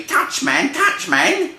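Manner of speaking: high, loud street-slang voice; little brother mouse. Acted. Brothers take leave of Freddy, high and cocky. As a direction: squeal a cocky goodbye.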